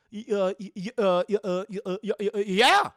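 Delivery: stuttering, bursting into a loud yeah; flustered stammer. Retold or imitated. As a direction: stammer until the yes breaks loose. Flustered librarian asked on date.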